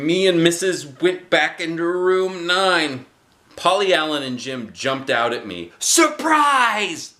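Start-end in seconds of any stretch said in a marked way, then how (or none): 5.8-7.1 much higher, louder shout; hollered surprise. Acted. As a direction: kids jumping out yelling. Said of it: "they hollered".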